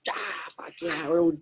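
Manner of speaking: high, eager mock cheer; cheering crowd. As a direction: join in like a happy pub crowd. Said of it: "And everyone goes".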